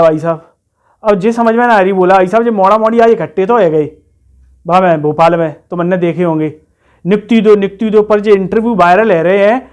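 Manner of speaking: loud, fretting village lad; rustic job candidate. Acted. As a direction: air a worry loudly in rustic dialect. Plays rustic candidate fretting over appointment.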